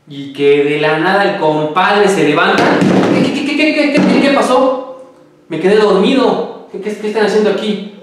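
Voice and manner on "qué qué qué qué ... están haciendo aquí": much higher, sputtering startled voice; startled waking. Acted. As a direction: stammer in shock as the corpse wakes. Muerto se levanta confundido. (voz muy aguda y sobresaltada, tartamudeando)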